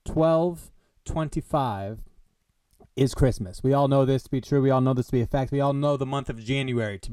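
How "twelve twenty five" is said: twelve twenty-five drawn out word by word; dramatic slow twelve twenty-five; slow emphasis. Shown as a demonstration. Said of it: "December twenty-fifth".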